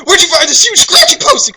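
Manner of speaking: high, loud squeal of delight; excited Claus. Acted. Reader voicing the excited Claus, high and loud. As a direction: squeal it out like a thrilled kid. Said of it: "asked an excited Claus".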